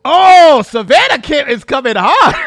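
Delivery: high, loud burst of glee; thrilled. Real, not acted. Streamer thrilled by the interviewer's tough question, excited. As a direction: shout out your delight.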